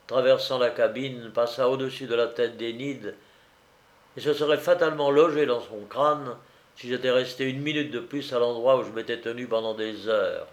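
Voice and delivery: plain reading voice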